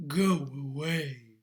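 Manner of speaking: deep, loud dragon sending someone away; low, imperious storybook dragon. A narrator's reading. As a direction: boom it out low and heavy as the dragon. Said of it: "The dragon stuck his nose out ... and said".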